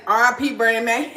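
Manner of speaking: ordinary talk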